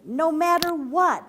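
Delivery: urgent. A narrator's reading. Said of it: "added Father".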